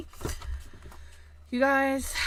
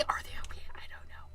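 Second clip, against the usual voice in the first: whisper; 10 dB quieter, much faster than usual. Real, not acted. Whispered aside continues.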